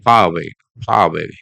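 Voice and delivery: FAR AWAY joined by an R before the vowel; linking R. Shown as a demonstration. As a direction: far away run together as one phrase. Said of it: "silent unless followed by vowel".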